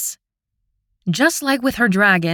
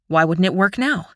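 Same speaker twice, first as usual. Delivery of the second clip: usual speaking voice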